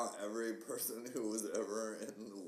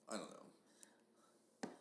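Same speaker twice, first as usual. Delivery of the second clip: whispered; 11 dB quieter, much slower than usual. Real, not acted. Trailing off, whispered.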